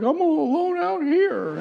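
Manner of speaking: very high, hammed-up wail; fake crying. Husband on the phone backstage to his wife, badly faked wailing. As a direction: outdo the wife's whimper with phony misery. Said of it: "like, an acting exercise".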